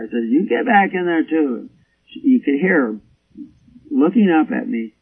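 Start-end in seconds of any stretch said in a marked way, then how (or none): 0.3-1.6 mild mock yell, pitched up; higher-pitched order, only half shouted; retold yell. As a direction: scold a straying sheep from afar. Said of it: "and I yelled back at Cora".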